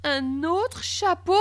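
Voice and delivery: high, drawn-out question; baffled surprise. A narrator's reading. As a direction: ask it slowly in disbelief. Boy baffled by new hat. (stupéfait, question aiguë et lente)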